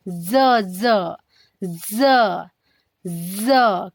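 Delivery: ज as a long buzzing z, low; घिसटती ज़ ध्वनि. Shown as a demonstration. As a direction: drag out ज with tongue forward at the upper teeth. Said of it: "घसीट के आवाज निकालनी है". (ज को घसीटकर ज़ बोलें)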